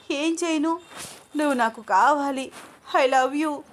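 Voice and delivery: cling to him, desperate and adoring; obsessed lover. Acted.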